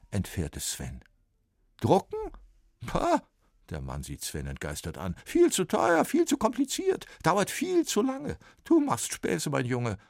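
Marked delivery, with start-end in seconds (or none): 1.8-10.0 high, scornful dismissal; scoffing. A narrator's reading. Copyist scoffs at Sven's printing idea. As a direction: laugh off the silly idea.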